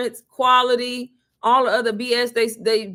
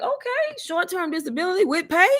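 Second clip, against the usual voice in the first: surprised; 9 semitones higher than usual. Retold or imitated. Learning about paid leave, much higher and amazed. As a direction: pitch leaps way up in disbelief.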